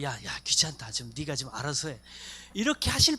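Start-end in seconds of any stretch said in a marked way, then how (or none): 0.0-2.0 lower, offhand grumble, lightly voiced; a mock annoyed Lord waving you away; the Lord, playfully voiced, shrugging off a pestering prayer; bothered Lord brushing off. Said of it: "주님도 그러시죠".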